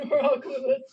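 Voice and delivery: laughing through the words. Real, not acted. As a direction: burst into laughter saying it. Repeats it laughing.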